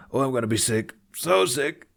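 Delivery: mock nausea, dragging it out; queasy moaning. A narrator's reading. Tufnut moaning to the twins about being sick, hammed-up. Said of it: "Tufnut retched".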